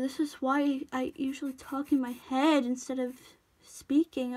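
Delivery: ordinary delivery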